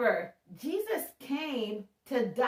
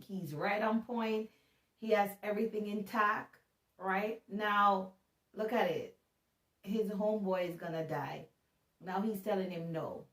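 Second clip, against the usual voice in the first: normal talking voice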